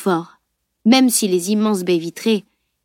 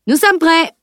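a much higher, louder shout; 8 semitones higher, 5 dB louder than usual. A narrator's reading. Knight shouting to the tutor that they're ready. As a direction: call it out loud and high. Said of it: "cria-t-il".

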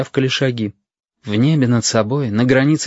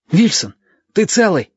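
put-on higher boyish voice, anxious; worried boy calling out; Yashka's worried call; 8 semitones higher, 3 dB louder than usual. A narrator's reading. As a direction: call down to a friend lying still. Yashka calls to fallen Stasik.

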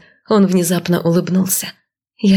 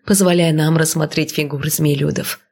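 usual speaking voice

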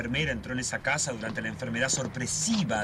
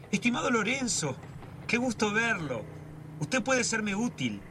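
higher, slow, coldly polite imitation of the old man; icy Don Alfonso; 7 semitones higher than usual. Brother retelling to sister, mocking the old man's icy greeting. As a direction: mimic the landlord's frozen courtesy, slowly. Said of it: "sonrió con una mueca helada".

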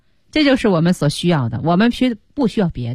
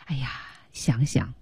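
softly whispered musing; quiet thinking-aloud murmur; hushed aside; 6 semitones lower, 11 dB quieter than usual. Real, not acted. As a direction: drop to a near-whisper, as if thinking.

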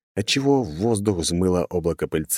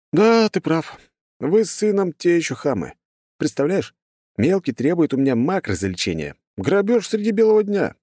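high-pitched, mock-outraged banter; smirking hero; 11 semitones higher than usual. A narrator's reading. Hero jokes about son's fee, smirking. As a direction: complain playfully in a high voice. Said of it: "усмехнулся я".

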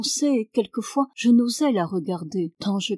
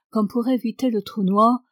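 ordinary delivery, nothing marked

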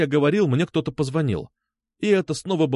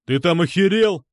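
raised, higher angry bark; 5 semitones higher, 4 dB louder than usual. A narrator's reading. Пётр rages at the repeated call, barking. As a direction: snap it loudly at the caller. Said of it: "рявкнул он".

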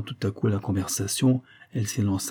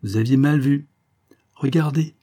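higher, louder carefree correction; heedless, impulsive; 5 semitones higher, 4 dB louder than usual. A narrator's reading. Girl urging Jacques to look at her eyes, heedless. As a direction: blurt it lightly, without thinking. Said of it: "ajouta-t-elle étourdiment".

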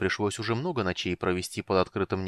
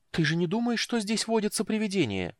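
higher, despairing fearful question; desperate; 11 semitones higher than usual. A narrator's reading. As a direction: ask it in desperation. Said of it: "в отчаянии спросила она".

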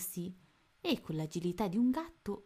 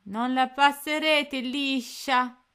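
trembling defiance; 7 semitones higher, 9 dB louder, slower than usual. A narrator's reading. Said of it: "la sua voce tremava".

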